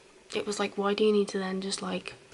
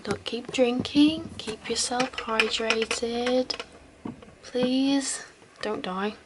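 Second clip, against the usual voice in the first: caring roleplay. Acted. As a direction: gently coax a drunk friend to drink water. Caring for a drunk friend.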